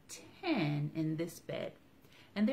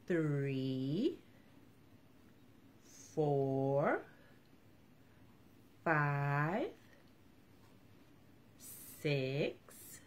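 slow counting aloud; much slower than usual. Acted. Counting slowly for children again.

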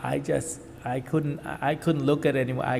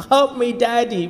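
put-on higher, louder pleading child's cry; imitating crying son's plea; 6 semitones higher, 5 dB louder than usual. Father retells son begging him during surgery, higher and louder plea. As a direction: voice the boy begging his father, pitched up and loud. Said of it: "That's what he's saying".

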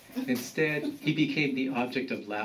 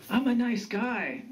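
higher-pitched grandson declaring himself; earnest declaration; 11 semitones higher than usual. A narrator's reading. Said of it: "Then he declared".

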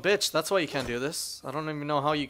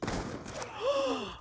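gasp; 9 dB quieter than usual. Real, not acted. Shocked at game event.

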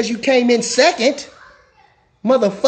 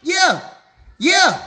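hyped shout; 8 semitones higher than usual. Real, not acted. Calling out to viewers on stream.

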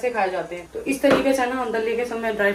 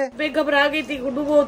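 crying; 4 semitones higher, 4 dB louder than usual. Real, not acted. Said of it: "रोना बंद कर दे पायल".

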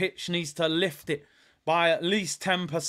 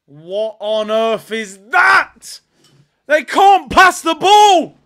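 very loud, slow then high furious yell; outraged shout; 3 semitones higher, 12 dB louder, slower than usual. Real, not acted. Fan screaming that his team can't pass. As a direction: vent full disbelief.

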